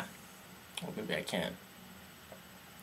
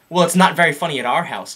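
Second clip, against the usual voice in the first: louder, stern mock caller voice; lightly voiced, louder stern caller; imitating the caller; 4 semitones higher, 6 dB louder than usual. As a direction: scold him like an offended parent. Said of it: "caller scolding Dan".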